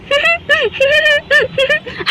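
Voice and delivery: high, soft amused laugh. Acted. Didi laughing at Sita over the wrong cow, high giggle. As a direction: burst into a high giggle at the confusion.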